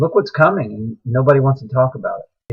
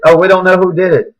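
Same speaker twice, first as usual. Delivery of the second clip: mocking official denial; 5 semitones higher, 6 dB louder than usual. Mocks officials denying blame.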